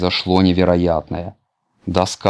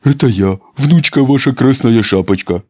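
put-on wolf faking girl; 5 semitones higher, 5 dB louder than usual. A narrator's reading. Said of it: "забубанил волк".

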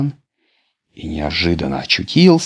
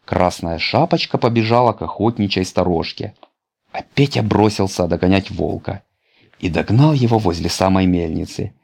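ordinary delivery

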